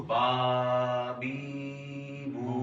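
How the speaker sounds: با بی بو drawn out long in a sing-song chant; wrong stretched reading. Shown as a demonstration. Teacher showing students the mistake of dragging syllables, sing-song stretched. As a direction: stretch each of با بی بو like a little song, the way not to read them. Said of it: "کھینچ کے نہیں پڑھنا".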